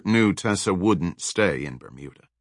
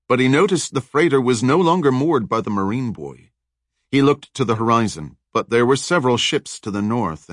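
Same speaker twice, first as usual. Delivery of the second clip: usual speaking voice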